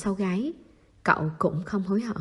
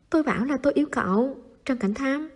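higher and fast, half annoyed; exasperated; 6 semitones higher than usual. A narrator's reading. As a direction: blurt it out, fed up with his teasing. Exasperated repeated confession.

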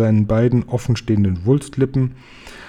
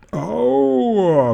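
long, high-pitched wail; hammed-up giant bellowing in agony; giant's roar of pain; 10 semitones higher than usual. A narrator's reading. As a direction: howl it out as one drawn-out cry of pain. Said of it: "brüllte der Fleischfetzenfresser".

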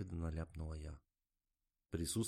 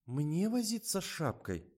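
astonished; 11 semitones higher, 5 dB louder, slower than usual. A narrator's reading. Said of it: "изумилась та".

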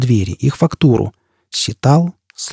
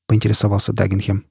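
usual speaking voice

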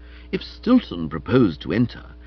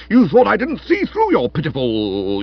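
jealous outburst; 6 dB louder than usual. Acted. Stilton accusing Florence of fooling him, loud and outraged. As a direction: rage until words run out.

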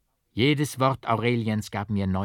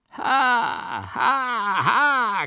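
piercing high madman's cackle, much higher and louder; shrieking mad laugh; 14 semitones higher, 6 dB louder, much slower than usual. A narrator's reading. The raving double bursts into the church, shrieking laughter. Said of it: "kreischte der Rasende mit gellender Stimme".

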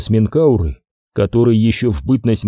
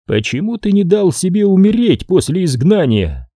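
higher eerie oracle voice; prophetic trance voice; 8 semitones higher than usual. Acted. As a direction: ask like a distant seer. Oracle asks its question.

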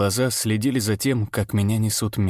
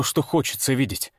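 ordinary delivery

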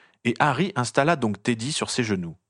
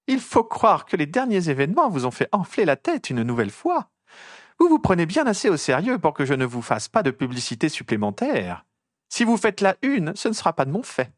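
voix nettement haut perchée, piquante; toise-le d'une voix haute; journaliste vexée et hautaine; 7 semitones higher than usual. Acted. (journaliste piquée au vif, voix aiguë)

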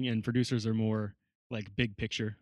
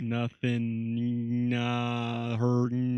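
reversed-sounding gibberish; backwards speech; much slower than usual. Shown as a demonstration. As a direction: say it as if played in reverse. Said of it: "let's try to say that backwards".

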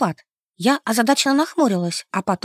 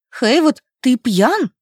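louder, voice catching in alarm; voice breaking; 4 dB louder, slower than usual. Acted. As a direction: let the voice crack with fright. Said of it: "срывающимся голосом произнесла я".

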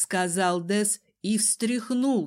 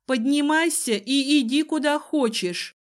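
raised, high command; sharp dismissal; 6 semitones higher, 5 dB louder than usual. A narrator's reading. Heroine sends the cowering cousin away, sharp and loud. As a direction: order it loudly and impatiently.

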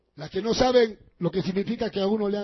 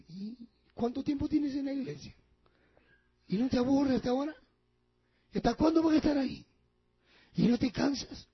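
devil whispering temptations; 3 semitones higher, 6 dB quieter than usual. Retold or imitated.

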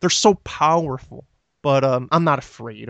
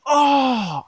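startled yelp; 12 semitones higher than usual. Retold or imitated. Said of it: "I'm like".

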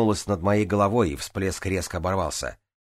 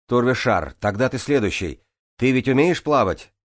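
high, loud call upward; 5 semitones higher, 5 dB louder than usual. A narrator's reading. Swimmer asks Турвишар to go next, calling out.

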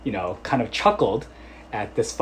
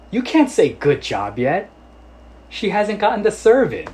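joking coach scold; 9 semitones higher, 6 dB louder than usual. Retold or imitated. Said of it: "just jokingly".

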